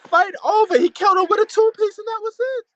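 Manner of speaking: loud, high fight call; excited shouting. Real, not acted. Fight fan calling the two-punch knockout, excited shouting.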